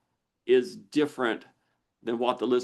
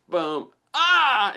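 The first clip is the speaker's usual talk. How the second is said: startled cry; 10 semitones higher, 6 dB louder than usual. Retold or imitated. Acting out a strong trigger.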